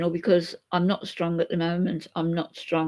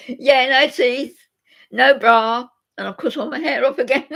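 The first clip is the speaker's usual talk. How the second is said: laughing while talking; 6 semitones higher, 7 dB louder than usual. Real, not acted. Joking about no teeth, bra.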